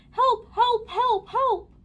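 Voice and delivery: high, loud repeated cries; screaming for help. A narrator's reading. Policeman stuck in a traffic jam, screaming. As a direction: scream each word. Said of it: "A policeman was screaming".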